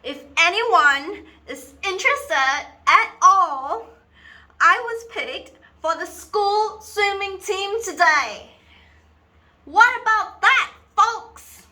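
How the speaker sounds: slow, high-pitched yell to the whole house; shouted announcement. Acted. Child announcing swimming team news to an uninterested household, loud sarcastic shout. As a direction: bellow it out word by word for everyone to hear.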